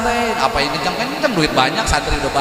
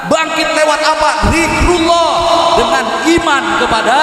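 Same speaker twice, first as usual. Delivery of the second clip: zikrullah shouted out, high and loud; preaching shout; 9 semitones higher, 3 dB louder than usual. Acted. Preacher to congregation, shouting the answer. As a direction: call it out to the whole hall.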